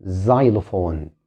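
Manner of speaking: xylophone said very slowly, starting with a z; z sound at the start of xylophone; slow demo. Shown as a demonstration. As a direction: stretch out xylophone with a z. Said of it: "ممكن ينطق مثل حرف ال Z".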